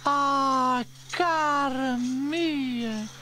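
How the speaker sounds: high, loud, long-drawn Italian endearment; high smiling character voice, stretched out; Bella delighted. A narrator's reading. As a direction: greet them fondly with a big smile. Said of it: "with a great big smile".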